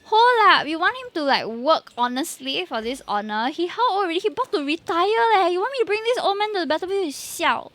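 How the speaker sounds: playing up a Singaporean Singlish accent in protest. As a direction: Singlish rant with leh and siao. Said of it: "usually I don't talk like that".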